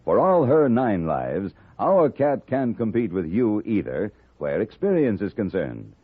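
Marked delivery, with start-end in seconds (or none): none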